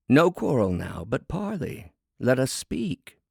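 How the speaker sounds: a hint of a creature calling a truce; harpy asking to parley. A narrator's reading. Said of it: "her palms up as to say".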